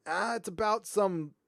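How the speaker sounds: normal talking voice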